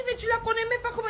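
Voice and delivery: indignant outburst at the father; shouted question. Retold or imitated. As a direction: yell it in disbelief. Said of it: "Il dit".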